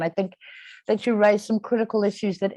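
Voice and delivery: ordinary delivery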